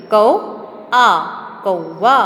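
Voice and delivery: कौ and आ split, then कौवा said whole; teacher sounding out कौवा for children. Shown as a demonstration. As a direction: break कौवा into कौ and आ, then join. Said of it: "क में बड़े ओ की मात्रा लगी".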